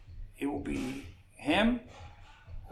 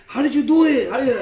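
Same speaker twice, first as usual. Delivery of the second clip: amazed disbelief; 10 semitones higher, 13 dB louder than usual. Retold or imitated. Fighter amazed at the healed cut.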